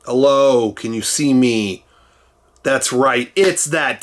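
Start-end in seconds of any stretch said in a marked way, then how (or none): none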